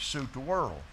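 ordinary talk, nothing marked

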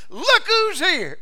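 high, loud, slow cry of joyful discovery; joyful greeting. Acted. New believer greeting the Savior, joyful slow exclamation. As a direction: call out as if you spotted a long-lost friend.

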